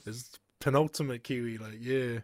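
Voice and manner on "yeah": ordinary talk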